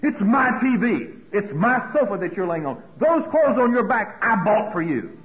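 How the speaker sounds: loud, high, indignant self-imitation; possessive ranting. Parent claiming the TV and sofa, indignant ranting. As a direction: hammer out each claim loudly. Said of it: "you know what I sounded like".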